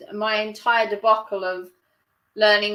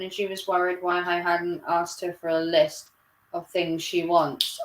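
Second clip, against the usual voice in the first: ordinary delivery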